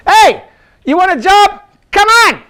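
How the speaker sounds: high, loud "Hey, you want a job?"; hiring a worker with a shout; calling out. Retold or imitated. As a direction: call over to the worker. Retold calling a worker over.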